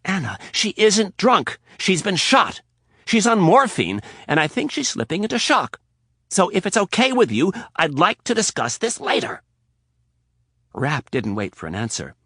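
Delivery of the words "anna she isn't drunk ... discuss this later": high, loud, tense insistence; urgent explanation. Acted. Rapp explaining Donatella's wound to Anna, tense and high. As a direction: press it on her.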